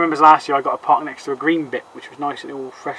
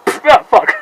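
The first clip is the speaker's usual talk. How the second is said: frustrated yell; 10 semitones higher, 9 dB louder than usual. Real, not acted. Frustrated burst while playing.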